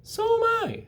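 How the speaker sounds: very high, slow tiny voice; tiny spider greeting the pig; small spider voice. Acted. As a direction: squeak it like a baby spider. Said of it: "said another tiny voice".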